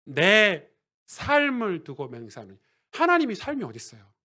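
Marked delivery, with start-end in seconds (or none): 0.0-2.6 slow, loud divine oath; weighty, drawn-out proclamation; solemn oath. Acted. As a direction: swear it slowly and forcefully, drawing out each word. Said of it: "이렇게 맹세한 적 있나요".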